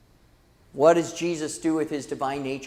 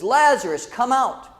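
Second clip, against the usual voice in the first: higher, slightly raised voice, acting the call; mild mock shout; 5 semitones higher, 4 dB louder than usual. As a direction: call toward the tomb as Jesus, restrained. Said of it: "he shouted with a loud voice".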